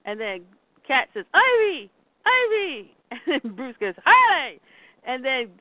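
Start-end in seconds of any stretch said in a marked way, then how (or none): 1.3-2.9 acted urgent call, much higher; high retold cry of a name. Cat calling Ivy to wake. 4.0-4.6 acted yell, higher and louder; retold urgent call; Bruce. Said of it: "Bruce is yelling at Harley".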